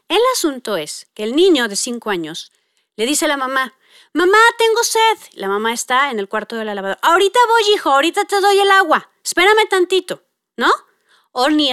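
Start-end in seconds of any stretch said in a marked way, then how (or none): 4.1-5.2 put-on high, louder child voice asking mom. Thirsty five-year-old calls his mom, high pleading child voice. Child asks mother for water. 7.0-9.1 higher, hurried mother calling from the laundry; busy mom. Retold or imitated. Busy mother calling back to her thirsty son, hurried. 9.2-10.2 Espérame said higher, with a fall; busy mom. Retold or imitated. Mother putting off her son, Espérame higher and falling.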